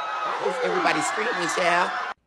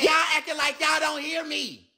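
usual speaking voice

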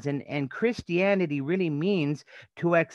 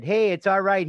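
friend calling out; 6 semitones higher, 6 dB louder than usual. Retold or imitated. Friend calls out to guard.

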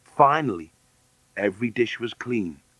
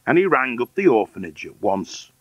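ordinary delivery

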